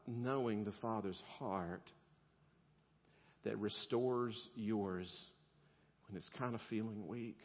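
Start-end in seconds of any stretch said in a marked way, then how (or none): none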